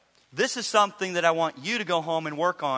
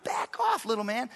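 much higher, indignant mock challenge; mock indignant dad; 10 semitones higher than usual. Dad confronting his son in the living room, mock indignant. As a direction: puff up like an offended father. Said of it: "I'm sitting here in the living room".

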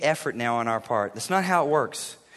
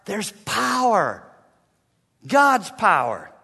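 fiery exhortation; 2 semitones higher, 4 dB louder than usual. Acted. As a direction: drive it home like a preacher. Preaching Peter's charge at full force.

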